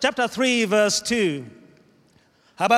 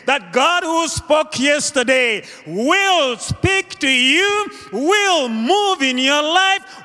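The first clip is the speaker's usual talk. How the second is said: building from steady to high, ringing proclamation; impassioned preaching; 7 semitones higher, 3 dB louder than usual. Acted. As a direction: drive it upward, phrase after phrase, to a climax. Preaching builds to full force.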